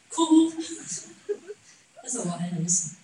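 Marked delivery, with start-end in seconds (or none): 0.1-0.5 a high, loud hammed-up wail on 哭; mock wail. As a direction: put on a showy, fake wail. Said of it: "做戏给人家看". (夸张做作的假哭，高而响)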